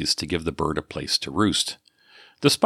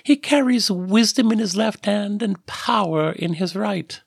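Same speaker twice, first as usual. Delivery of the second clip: much higher, grand mock herald announcement; playful grand proclamation; mock ostentation. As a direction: puff it up like a pompous crier, tongue in cheek. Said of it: "announced Miri with mock ostentation".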